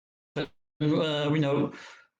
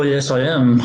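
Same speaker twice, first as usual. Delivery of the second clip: ordinary talk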